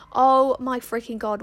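loud, drawn-out exasperation; exasperated outburst. Real, not acted. Podcaster interrupted by a tractor outside her room, exasperated outburst. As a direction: burst out, stretching the words in disbelief.

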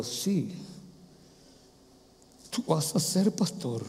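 soft, slow, hushed answer. Acted. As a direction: bring it right down, gently and unhurried. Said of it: "Y la voz de Dios se bajó".

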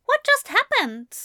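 high, startled yelp; a high, panicked question; alarmed yelp. A narrator's reading. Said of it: "yelped, truly alarmed".

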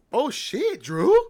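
high, loud surprised recognition; hearing a long-lost friend's voice, loud surprise. Retold or imitated. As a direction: react like an old friend is calling. Surprised to hear old friend.

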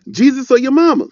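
emphatic challenge. Real, not acted. Challenging the congregation.

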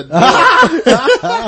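laughing hard through the words. Real, not acted. Telling friends about the kicked door, laughing. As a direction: laugh out the punchline. Laughing at his own story.

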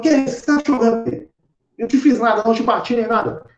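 high, puzzled protest; taken-aback spirit. Acted. Spirit finding the doctor in tears, acted baffled protest. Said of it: "o espírito falou".

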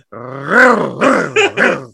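rising and falling playful growl; mock growl like a hungry predator; imitated predatory growl. Imitating a wife eyeing him like a shark, mock growl. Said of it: "and she's like".

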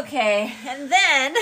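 loud, high, through real laughter; laughing. Real, not acted. Partners telling their reunion story, laughing loudly. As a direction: laugh while moving the story along.

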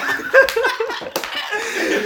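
high wheezing laugh. Real, not acted. Laughing at own story.

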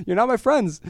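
playfully acting hurt by coffee critics; mock offended.

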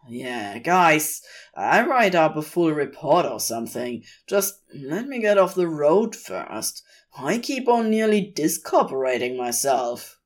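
plain reading voice

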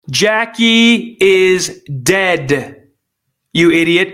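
very slow, each word dragged out; scolding Biden, drawn out; slow exasperated retort. Real, not acted. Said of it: "punch the answer at Biden".

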